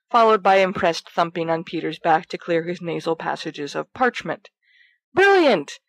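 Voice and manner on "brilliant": excited. Acted. Sirius thrilled by Peter's idea.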